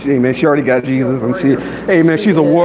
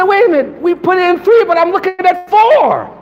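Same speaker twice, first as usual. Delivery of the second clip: amazed; 12 semitones higher, 3 dB louder than usual. Retold or imitated. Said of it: "And he said".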